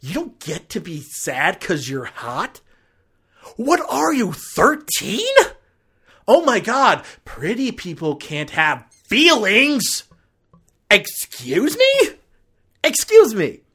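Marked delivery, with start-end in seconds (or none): 3.6-5.4 scornful jab. Real, not acted. Mocking the movie character. 6.3-13.6 loud, higher, incredulous outburst; outraged disbelief. Real, not acted. Vlogger reacting furiously to a scene in the movie, loud and incredulous.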